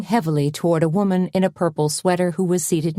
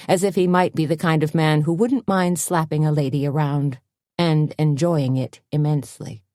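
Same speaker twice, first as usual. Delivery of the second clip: ordinary delivery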